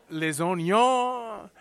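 put-on slow, drawn-out Cajun accent; Cajun accent like Justin Wilson. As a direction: savour the words in a Cajun accent. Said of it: "he had that strong Cajun accent".

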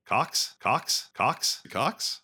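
each COX lilting sharply upward; teasing repetition. Shown as a demonstration. Teasing a friend who likes hearing it, questioning lilt. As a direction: repeat COX as a high, rising question. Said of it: "hearing Cox said over and over again".

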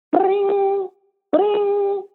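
high imitated phone ring. Author mimicking a ringing phone for the story. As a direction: ring like a telephone.

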